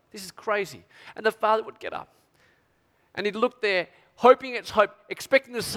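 normal talking voice

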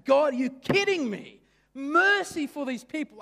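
high, loud outraged cry at God; angry Jonah. Retold or imitated. Preacher voicing Jonah furious at God's mercy. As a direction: shout it like an indignant prophet. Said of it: "Like, he's angry".

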